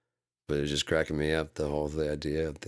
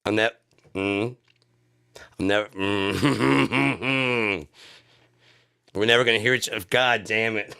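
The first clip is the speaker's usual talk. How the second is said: exasperated; 5 semitones higher, 6 dB louder than usual. Acted. Acting out the Zoom argument.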